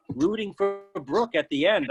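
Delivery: usual speaking voice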